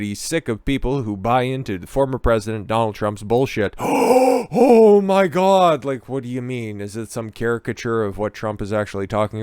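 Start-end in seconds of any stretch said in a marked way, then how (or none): 3.8-5.8 mock shock. Mock shock at Stern quote.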